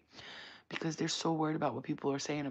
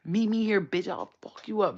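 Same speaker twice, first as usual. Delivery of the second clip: louder, raised-pitch mock threat; imitated threat; 5 semitones higher, 6 dB louder than usual. Imitating sister threatening people online, angry challenge. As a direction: act out the sister picking a fight. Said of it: "what she used to threaten people".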